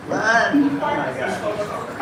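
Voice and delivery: ordinary delivery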